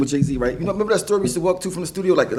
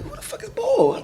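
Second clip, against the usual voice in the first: laughing disbelief; 3 dB quieter than usual. Retold or imitated. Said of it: "I'm like".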